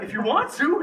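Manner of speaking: high, loud, laughing mock reply; comic obvious-answer retort. Retelling his answer to a helper, laughing mock incredulity. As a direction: toss it back through amusement.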